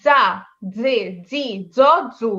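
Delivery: "জা, জে, জি, জ, জু" with a dz sound; Z pronunciation. Shown as a demonstration. Teacher showing Zeta for learners to follow, pronunciation demo. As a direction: separate dz syllables, paused between. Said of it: "আমাকে follow করে দেখেন যে আমি এটা কিভাবে পড়ছি".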